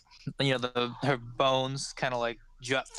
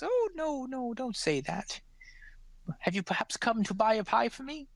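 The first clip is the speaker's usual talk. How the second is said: put-on fussing old woman; 7 semitones higher than usual. Old woman comforts the gnome then asks why he came, high voice. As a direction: gently scold then probe, up high.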